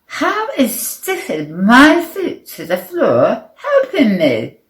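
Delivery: high, loud exasperated question; indignant protest. A narrator's reading. Player challenging the superpowers, indignant. Protests that sticking feet is no help.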